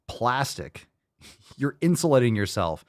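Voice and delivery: normal talking voice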